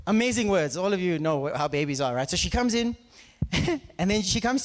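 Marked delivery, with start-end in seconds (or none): none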